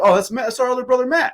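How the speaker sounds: high, fast, offhand; breezy answer. Retold or imitated. Brothers explaining who the giant is, a high quick offhand answer. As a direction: toss it off quickly and lightly.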